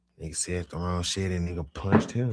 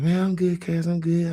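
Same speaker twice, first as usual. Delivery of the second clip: much higher, louder pleading; insisting he's fine; 12 semitones higher, 7 dB louder than usual. Retold or imitated. High cousin insists he's fine, squeaky and loud. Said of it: "He like".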